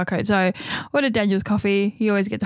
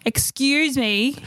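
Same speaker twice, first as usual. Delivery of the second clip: drawn-out excuse me; 4 semitones higher, much slower than usual. Retold or imitated. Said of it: "And I'm like".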